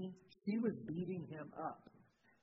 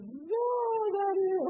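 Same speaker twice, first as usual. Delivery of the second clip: shocked outcry; 10 dB louder, slower than usual. Retold or imitated. Pastor's shocked outburst at invitation.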